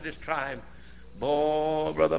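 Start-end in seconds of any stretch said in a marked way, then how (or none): 0.0-0.6 much softer, lower, hushed reply; hushed answer. Retold or imitated. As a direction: say it gently, barely above a breath. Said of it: "I heard that man say".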